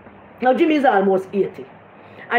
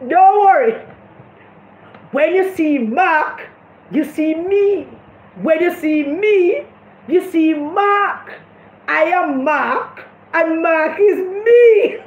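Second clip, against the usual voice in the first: slow, drawn-out, mock-solemn reassurance ending in a laugh; mocking Bunting; 4 semitones higher, much slower than usual. Mocking Bunting reassuring Jimmy, slow and hammed up. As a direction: ham up a pompous promise. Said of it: "Peter Bunting said to Jimmy".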